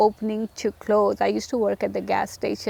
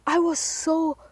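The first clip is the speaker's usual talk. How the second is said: terrified; 6 semitones higher than usual. Real, not acted.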